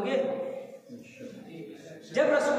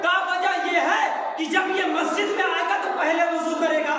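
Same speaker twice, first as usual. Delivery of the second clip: raised, shouted sermon lines; fiery preaching; 4 semitones higher than usual. Acted. Preacher urging the majlis crowd, at full force. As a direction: drive each clause home like a pulpit climax.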